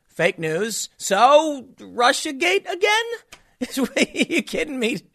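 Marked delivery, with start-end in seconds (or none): 1.0-3.1 much higher, disbelieving rise; can't believe it's back; incredulous. Real, not acted. Hearing Russiagate is back, incredulous rise. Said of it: "I can't believe it". 3.6-5.0 laughing, high and incredulous; disbelieving laugh. Real, not acted. Reacting to the Russia story, laughing disbelief. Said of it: "I can't believe it".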